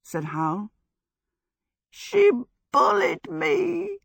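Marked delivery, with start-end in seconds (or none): 1.9-4.0 high, slow whimper of self-pity; piteous, muffled. Acted. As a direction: whine it pitifully, nearly crying. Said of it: "in a piteous, muffled voice".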